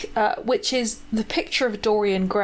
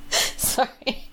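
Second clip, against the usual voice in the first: giggling through an apology; can't stop laughing; 5 dB quieter than usual. Real, not acted. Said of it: "I'm trying not to laugh".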